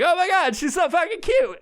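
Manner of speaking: very high mock squeal; gushing swimmers. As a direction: imitate oblivious admirers cooing over a dog. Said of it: "And they were like".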